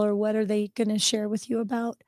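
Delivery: ordinary delivery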